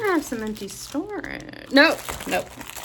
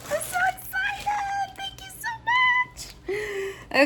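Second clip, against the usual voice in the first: tiny, very high squeal of excitement; excited squeal. Real, not acted. Overwhelmed by unboxing excitement.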